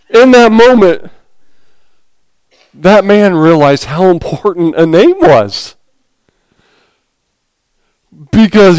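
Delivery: words squeezed out high and loud through real laughter; can't stop laughing at the punchline while speaking. Real, not acted. Preacher laughing at his own joke.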